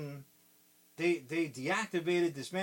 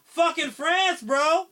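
much higher, louder triumphant yell; mock soldier shout; 13 semitones higher, 12 dB louder, slower than usual. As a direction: yell the punchline at the top of your voice. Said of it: "now they're like".

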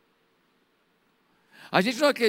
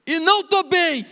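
much higher and louder, breaking out; anguished cry; 7 semitones higher, 6 dB louder than usual. Pretend. Alone before God, an anguished outcry. Private prayer, crying out.

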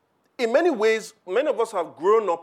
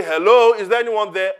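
loud "Hello" called out, then a questioning echo; calling out into an empty house; 3 semitones higher, 8 dB louder than usual. Pretend. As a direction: call out into an empty mansion, hoping someone answers.

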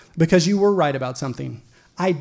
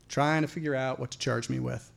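ordinary delivery

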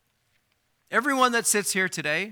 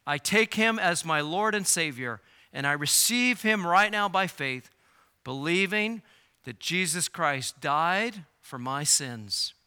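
ordinary delivery

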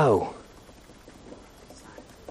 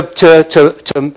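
usual speaking voice

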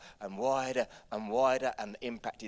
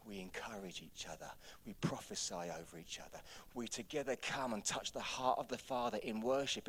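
hushed preaching; 8 dB quieter than usual. Real, not acted. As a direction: bring it right down after the climax. Drops to hushed preaching after climax.

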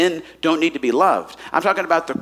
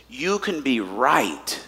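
slow quote; 8 semitones lower, 6 dB quieter, much slower than usual. Retold or imitated. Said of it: "He says this".

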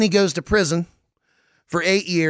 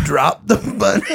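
laughing through a higher mock drawl; hammed-up Southern accent, breaking up; 4 semitones higher, 3 dB louder than usual. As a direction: quote it back giggling in a country drawl. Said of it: "a drunken Southern drawl the whole time".

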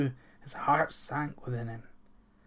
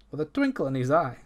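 plain reading voice

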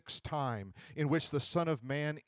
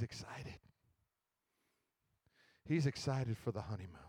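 tender hush; 4 semitones lower, 4 dB quieter than usual. Acted. Picturing Jesus weeping with joy for his bride, softly tender. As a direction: hush the room as if describing something precious.

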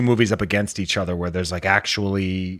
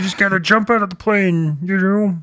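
high, louder frog puppet voice; Kermit voice; 9 semitones higher, 4 dB louder than usual. Pretend. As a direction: do your best Kermit. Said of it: "I don't even know if this sounds like Kermit".

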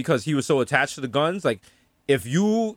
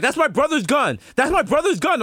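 mock panicked plea; 11 semitones higher than usual. Stressed friend pleading.

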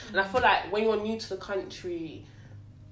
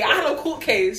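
usual speaking voice

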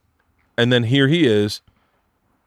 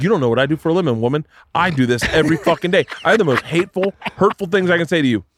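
retold rage; 6 semitones higher than usual. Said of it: "when rage hits you".

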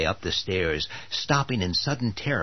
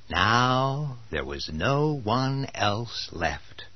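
ordinary talk

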